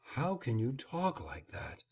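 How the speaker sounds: soft, weak protest; mother's faltering, quiet reproach; feeble protest. A narrator's reading. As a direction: protest feebly, losing her grip. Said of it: "she kept protesting feebly".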